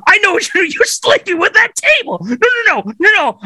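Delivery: way up high, shrill accusation; wife catching Tim with the table; high accusing wife. Acted.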